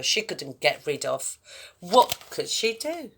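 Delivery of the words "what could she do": usual speaking voice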